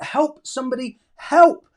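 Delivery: a plain "Help somebody" then a sudden high, loud "help"; acted cry for help. Shown as a demonstration. Teacher reading a pupil's sentence to the class, last "help" cried out. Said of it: "reading pupil's sentence aloud".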